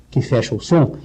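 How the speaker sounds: ordinary delivery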